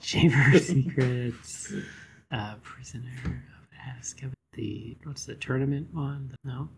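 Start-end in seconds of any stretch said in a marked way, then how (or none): 2.3-6.7 whispered guess to himself; whispering. Real, not acted. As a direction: breathe the title without voice. Unvoiced guess under breath.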